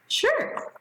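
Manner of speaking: cheery sure. Acted. Cheerful answer to student request.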